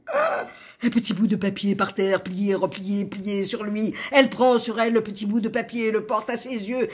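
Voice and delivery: excited narration. A narrator's reading. Narrator as La L spots the paper, excited. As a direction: tumble through it eagerly.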